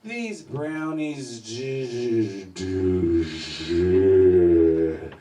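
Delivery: slow, dragged-out letdown; sleepy stoned complaint; disappointed groggy mumble. Retold or imitated. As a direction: drawl it like a let-down stoner. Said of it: "I'm walking to the bathroom like".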